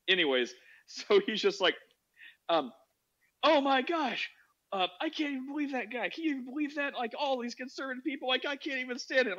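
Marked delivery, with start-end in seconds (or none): 3.4-9.4 high-pitched, exasperated imitation of a friend; mock indignation. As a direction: act out his appalled disbelief, pitched up. Retelling friend's outrage at conservatives.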